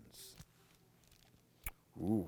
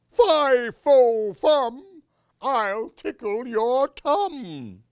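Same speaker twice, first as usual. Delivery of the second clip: much higher, loud booming giant chant; giant monster; 13 semitones higher, 12 dB louder, slower than usual. Acted. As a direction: stomp out fee-fi-fo like a giant.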